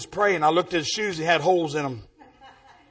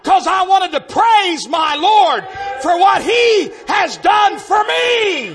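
loud, high, slow shouted praise; exultant preaching; 6 semitones higher, 4 dB louder, slower than usual. Real, not acted. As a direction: stretch each phrase out in a jubilant cry.

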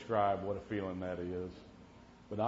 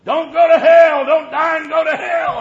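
big revival preacher bellow, much higher and louder; preacher shouting; 21 dB louder than usual. Retold or imitated. Old preacher shouting at the congregation, booming. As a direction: hellfire preacher yelling warnings. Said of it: "and he'd say".

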